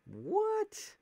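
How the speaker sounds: very high, loud yelp of surprise; startled, sky-high outburst; shocked disbelief. Real, not acted. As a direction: squawk it in disbelief. Attack did not finish the opponent.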